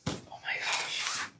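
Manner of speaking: slow breathless whisper; hushed, slow wonder; awed whisper. Real, not acted. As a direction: barely breathe the words in awe.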